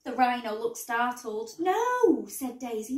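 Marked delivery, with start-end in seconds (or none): 1.6-2.3 high, loud refusal; emphatic protest. A narrator's reading. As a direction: object urgently, voice leaping up. Daisy objects to phoning zoo.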